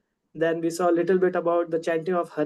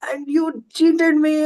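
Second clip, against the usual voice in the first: higher-pitched, mock-indignant gopi; retold fury; 8 semitones higher than usual. Gopi confronts cheating Krishna, acted fury. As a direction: act out a scolding, voice raised in pitch. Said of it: "And she becomes furious".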